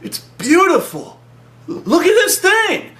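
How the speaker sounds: high, slow, enraptured voice; hammed-up wonder; awestruck, dreamy. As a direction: swoon over the thing, slowly. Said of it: "the guy's like".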